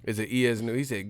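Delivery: ordinary talk, nothing marked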